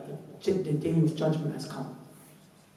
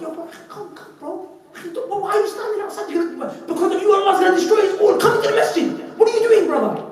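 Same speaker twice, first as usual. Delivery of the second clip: mock respectful call; 6 dB louder than usual. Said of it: "nice, respectful way".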